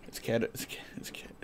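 breathy unvoiced whisper. Real, not acted. Muttering to chat about cats, whispered. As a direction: whisper it conspiratorially.

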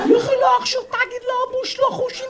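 imitated high-pitched gabble; ringtone-like chatter. Friend answering his mate at night, mock ringtone gabble. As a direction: babble back on one very high note. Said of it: "הוא עונה לו".

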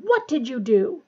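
loud, restrained bellow; angry bellow. A narrator's reading. Snape accusing Potter in the ward, bellowing a little. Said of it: "he bellowed".

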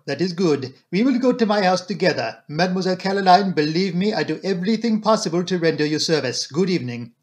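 put-on brisk, gallant French-accented detective; polite Belgian detective taking leave; courtly Poirot. Detective reassuring Caroline as he leaves, courtly.

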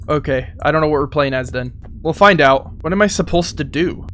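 usual speaking voice

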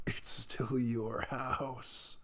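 slow whispered insistence. Acted. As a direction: gently whisper that it's their house. Insisting the host take the bed.

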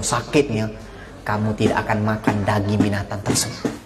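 normal talking voice

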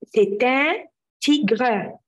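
drawn out, each word separate; slow model answer. Shown as a demonstration. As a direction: spell it out in sound for the class. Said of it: "كلنا نقول ورا بعض". (ببطء كلمة كلمة)

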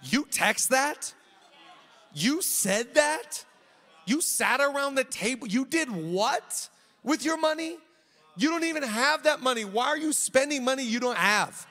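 a touch of high, loud disbelief; lightly voiced, scolding like an unimpressed parent; incredulous Holy Spirit. The Holy Spirit questions a believer's choices, lightly voiced and incredulous. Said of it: "need the Holy Spirit to be like".

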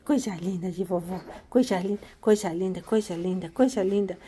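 low, soft doting murmur; tender coo. Real, not acted. Grandma dotes on the cat, soft low tender coo. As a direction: coo it low and softly, over and over.

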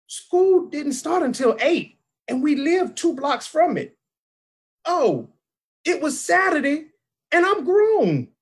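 high-pitched, exasperated protest; comic incredulity. Retold or imitated. Incredulous at 3 AM school wake-up.

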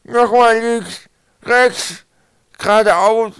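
high, loud, garbled words; swollen-mouth voice. A narrator's reading. Swollen boy gives Twig directions, muffled swollen-mouth voice. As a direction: force each word through bloated lips. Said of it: "war Knorpel so angeschwollen, dass sogar die einfachsten Worte unmöglich waren".

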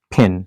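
ফ্যান said phen, with a puff of air on the p; ফ্যান: ph, not p; aspirated ph. Shown as a demonstration. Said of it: "not pen".

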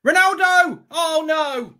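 loud high shout; long goal yell. Real, not acted. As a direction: yell his name at the goal. Second goal celebration.

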